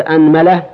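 أنملة said "anmala", a on hamza and mim; a-a vowels. Shown as a demonstration. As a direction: open on a, then "mala". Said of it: "هذا في الفتح".

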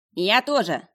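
loud, high-pitched dog's bark; barking talking dog. A narrator's reading. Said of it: "гавкнул пёс". (гавкнуть как говорящий пёс)

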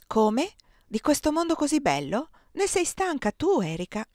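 astonished questions, pitched high; amazed that anyone could tire of it; incredulous. Acted. Incredulous questions at friend's world-weariness.

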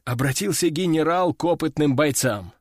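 usual speaking voice

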